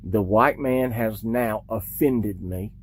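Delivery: low, slow mocking drawl; mock deep voice. Commentator mocking the man over a video, low and slow. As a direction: play a pompous offended man, slowly.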